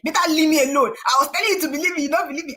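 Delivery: retold irritated snapping; snapping at mom. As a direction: push mom away, fed up. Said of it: "I'm like".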